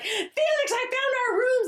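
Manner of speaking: excited sing-song call to her friend; childlike excitement. Retold or imitated. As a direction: call out to Felix like a thrilled kid. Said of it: "Very childlike".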